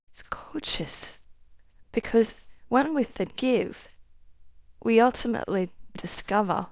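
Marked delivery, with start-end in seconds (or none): 0.1-1.2 soft, slow breathy whisper; barely voiced, slow, moved whisper; tearful whisper. Real, not acted. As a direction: whisper it, choked with feeling. Moved to tears by the memory.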